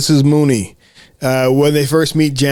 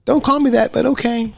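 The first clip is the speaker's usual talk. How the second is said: put-on kid voice; 10 semitones higher than usual.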